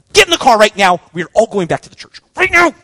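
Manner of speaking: panicked order. Acted. Telling friends to flee the tunnel, loud panicked order. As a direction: herd everyone out of there fast, voice up.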